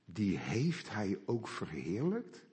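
slow, doubting question rising at the end; critical listener. Retold or imitated. A critical listener doubting Paul's words, slow incredulous question. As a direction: drag it out in disbelief, like a sceptic in the pews. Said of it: "Een hele kritische luisteraar die zegt".

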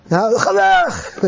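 drawn-out, half-hearted mock scream; slow pretend yell; mock shout. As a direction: imitate someone suddenly yelling, stretched out slow. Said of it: "keihard begint te schreeuwen".

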